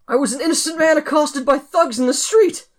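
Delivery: high, loud offended wail; indignant protest. Acted. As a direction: plead innocence in outrage. Protests innocence dramatically.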